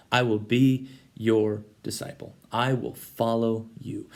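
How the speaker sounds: slow, earnest vow; solemn vow. Retold or imitated. Retelling his kneeling vow to Jesus, slow and solemn. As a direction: promise it slowly and solemnly. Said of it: "you said".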